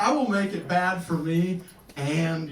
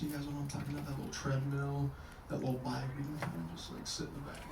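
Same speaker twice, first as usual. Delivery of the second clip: confiding hush; 3 semitones lower, 14 dB quieter, much faster than usual. Real, not acted. Confiding to audience.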